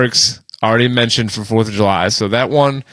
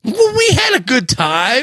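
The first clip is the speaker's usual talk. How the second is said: high mock cheer; 11 semitones higher than usual. Mock consolation after losing.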